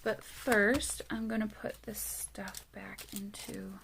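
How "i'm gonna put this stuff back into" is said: soft whisper while tidying up; half-voiced mutter to yourself; whispered aside. Real, not acted.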